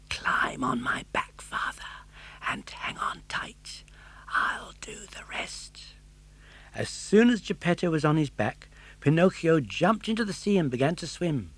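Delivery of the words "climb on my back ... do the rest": slow soft whisper. Acted. Pinocchio whispering to his father at the whale's mouth. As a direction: breathe the words, barely voiced. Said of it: "Pinocchio whispered".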